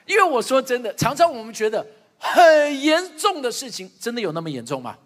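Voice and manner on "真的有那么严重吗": ordinary talk, nothing marked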